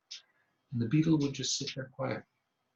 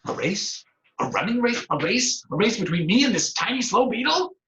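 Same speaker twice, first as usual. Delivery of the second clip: scoffing rat; 10 semitones higher, 6 dB louder than usual. Acted. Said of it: "The rat said".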